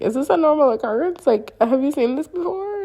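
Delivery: high, quick acted-out questions; bewildered. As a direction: check in disbelief with your companion. Voicing her silent look to relative.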